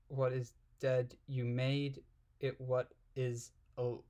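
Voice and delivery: ordinary delivery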